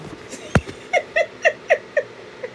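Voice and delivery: silent high wheezing laugh; airy giggle; breathy giggle. Real, not acted. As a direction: laugh without voice. Laughing at uncle's antics.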